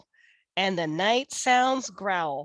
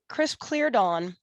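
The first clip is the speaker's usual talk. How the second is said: ordinary delivery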